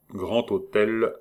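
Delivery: ordinary delivery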